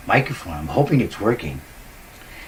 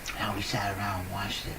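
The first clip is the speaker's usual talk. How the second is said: hushed whisper; 10 dB quieter than usual. Real, not acted. Remembering childhood quietly.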